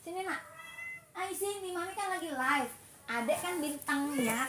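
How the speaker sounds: high, softened coaxing; pet talk. Real, not acted. Coaxing her cat back on camera.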